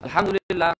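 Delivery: Alhamdulillah shouted short and sudden; loud startling Alhamdulillah. Shown as a demonstration. Preacher showing his waking intonation, loud. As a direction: punch Alhamdulillah out to jolt sleepers. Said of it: "intonasi yang sekiranya bisa membuat kaget".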